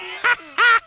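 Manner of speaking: high jeering laugh; squeaky rat cackle. A narrator's reading. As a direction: cackle in triumph. Rat laughing after mockery.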